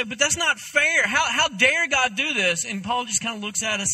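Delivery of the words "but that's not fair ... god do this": higher, louder mock outcry of protest; crying out. Preacher voices the human complaint against God, crying out. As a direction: cry out in indignant objection. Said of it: "We want to cry out and say".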